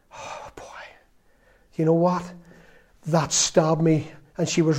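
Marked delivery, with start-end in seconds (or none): 0.1-0.6 breathless whisper of hurt; just breathe it out, stung; whispered sigh. Real, not acted. Father remembering his daughter's reproach, whispering.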